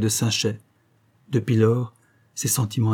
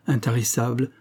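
ordinary delivery

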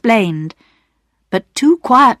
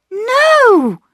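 much higher sudden protest; cried out. A narrator's reading. Girl protests to Perks, crying out. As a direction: burst in to stop him. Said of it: "cried Bobby".

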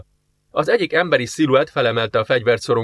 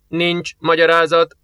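slow, higher flat machine reply; indifferent computer; 5 semitones higher than usual. Acted. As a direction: answer like an unmoved computer. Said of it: "felelte közönösen a számítógép". (közönyös géphang)

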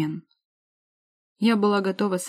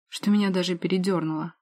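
ordinary delivery

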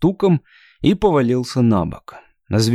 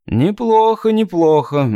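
mocking praise; 8 semitones higher than usual. Hunter mocks Sond's failed jump.

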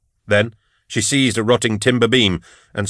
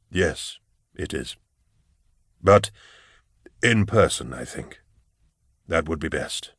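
low, soft, halting reply; grave old inquisitor; 4 semitones lower, 5 dB quieter than usual. Acted. Eisenhorn suggests meeting his pupil in person, low and quiet. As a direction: answer low and quietly, with measured pauses.

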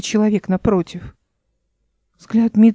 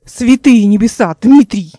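louder, astonished cry; shocked exclamation; 6 dB louder than usual. Acted. Эл cries out in shock at seeing Дмитрий. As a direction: call out the name in disbelief.

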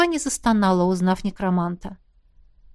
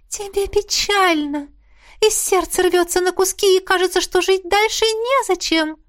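mock-tragic ghost voice; 10 semitones higher than usual. A narrator's reading. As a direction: lay on the gloom with delight.

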